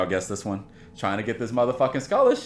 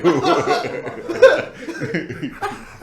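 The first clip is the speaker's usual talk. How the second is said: drill sergeant; 5 semitones higher than usual. Retold or imitated. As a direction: bark like a drill sergeant at a recruit. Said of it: "All from Full Metal Jacket".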